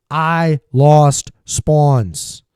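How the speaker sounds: I lost spawns drawn out, loud and slow; loud deliberate callout. Shown as a demonstration. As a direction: stretch and project it to the team. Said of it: "and you die".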